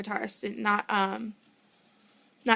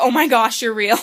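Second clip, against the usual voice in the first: louder, breathless amazement; starstruck exclamation; 9 dB louder than usual. Retold or imitated. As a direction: blurt it like you can't believe she exists. Poking [name] starstruck.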